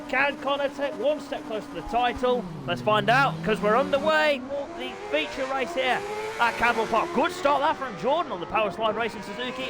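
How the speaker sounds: lively play-by-play commentator voice; upbeat live bike race commentary. Acted. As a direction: call the race like a TV motorsport commentator.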